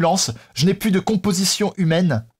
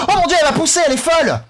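high, agitated protest; alarmed outcry; 9 semitones higher, 3 dB louder, faster than usual. Real, not acted. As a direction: exclaim in sudden gamer outrage. Alarmed as ball pushes him.

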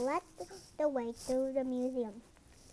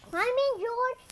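calling out; 10 semitones higher, 9 dB louder than usual. Acted. Man calls George into van.

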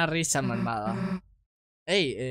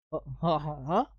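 evil laugh; 5 dB quieter than usual. Acted. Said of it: "es mi risa malvada".

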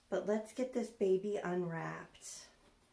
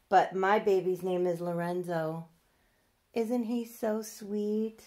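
ordinary talk

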